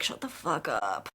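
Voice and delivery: low, deadpan dismissal; fed-up retort. Acted. Host reacting to men's flattery, low and fed up. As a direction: drop the pitch right down, unimpressed.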